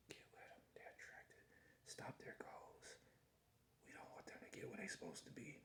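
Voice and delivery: hushed, breathy whisper of schemers; whispered quote. Retold or imitated. Retelling what schemers whispered against his goals, in a whisper. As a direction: whisper it like a secret plot. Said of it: "They was whispered".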